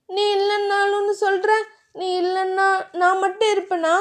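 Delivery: a touch of tearful hurt; hurt plea. Acted. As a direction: plead with him, wounded. Wife upset at his words.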